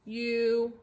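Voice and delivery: ordinary delivery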